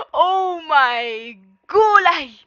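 slow, high, loud drawn-out exclamation; amazed cry. Real, not acted. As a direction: gasp it out in disbelief. Reaction to the rampage.

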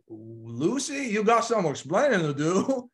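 slow mock scolding, climbing high after the name; sitcom husband scolding. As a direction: draw it out as a hammed-up sitcom catchphrase. Mimics sitcom husband's famous scolding line.

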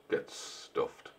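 unvoiced soft insult; whisper. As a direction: breathe it out rudely.

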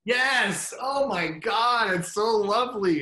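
high, loud and delighted, drawing out oh my God; gushing excitement. Real, not acted. Fan gushing to host about Bortus, excited shout. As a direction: burst out with real fan joy.

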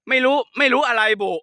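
high-pitched mimicry of Khon Kaen dialect; Khon Kaen accent. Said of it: "มันจะพูดภาษาอย่างนี้เลย". (เลียนสำเนียงขอนแก่น เสียงสูง)

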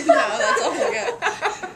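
slow words through laughter; laughing. Real, not acted. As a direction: laugh while speaking. Laughing after grandma bit.

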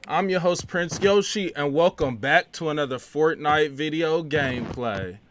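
hyped host intro. Acted. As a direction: open the show like a hyped-up host. Host opens the gameplay video.

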